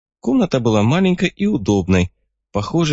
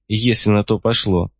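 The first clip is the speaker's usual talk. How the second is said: ordinary delivery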